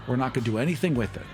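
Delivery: normal talking voice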